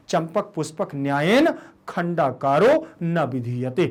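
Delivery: slow measured recital of the Sanskrit maxim; slow recitation. Shown as a demonstration. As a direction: lay out each word of the saying evenly. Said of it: "कैसे?". (सूक्ति को धीरे-धीरे पढ़ते हुए)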